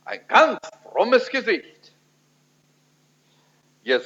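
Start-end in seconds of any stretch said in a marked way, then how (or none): 0.0-1.9 mock pious solemnity. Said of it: "war ich ganz ernst".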